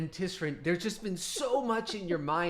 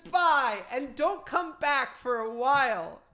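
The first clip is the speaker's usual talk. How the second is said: high, loud, huffy dismissal; snippy goodbye; 9 semitones higher, 10 dB louder than usual. Acted. Moonswallow huffily sending off the student who flipped on her. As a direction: shoo him off.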